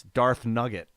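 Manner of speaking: ordinary talk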